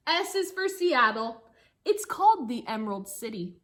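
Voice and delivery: lively storybook reading for a class; reading to children. Acted. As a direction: read the rhyme aloud to the kids, bouncy. Teacher reads picture book aloud.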